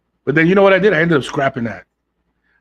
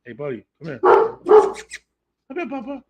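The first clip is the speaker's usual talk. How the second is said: calling the dog; 8 semitones higher, slower than usual. Real, not acted. Calling his dog mid-stream.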